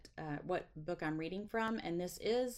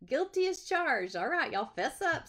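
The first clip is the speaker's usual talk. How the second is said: playful confession; 8 semitones higher, 6 dB louder than usual. Real, not acted.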